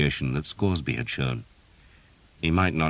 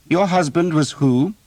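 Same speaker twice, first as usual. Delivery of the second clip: put-on higher, louder, sure young lord; confident young man; 14 semitones higher, 7 dB louder than usual. A narrator's reading. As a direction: toss the question off confidently. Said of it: "a very confident young man".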